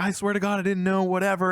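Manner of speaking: high, desperate pleading. Retold or imitated. Amir pleading with Robin that he didn't know, high and desperate. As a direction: beg to be believed. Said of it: "he's just pleading with him".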